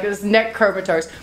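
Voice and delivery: usual speaking voice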